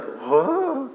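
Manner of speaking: high, hushed gasp of wonder; awed whoa. Retold or imitated. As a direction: breathe out amazed. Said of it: "You'd be like".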